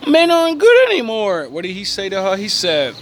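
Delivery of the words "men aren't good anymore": very high, loud mock whine; shrill mocking woman. Mocking women's complaint, loud high falsetto whine. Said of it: "other women that say".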